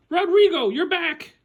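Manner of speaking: put-on high, delighted old lady. Old lady calling to her lost moose, high and delighted. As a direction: greet a lost pet with joy.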